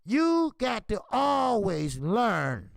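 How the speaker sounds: slow, loud, drawn-out lesson; emphatic preaching. Real, not acted. Boxing trainer lecturing fighters, slow and loud. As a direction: lean on every word like a sermon.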